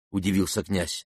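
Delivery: ordinary delivery, nothing marked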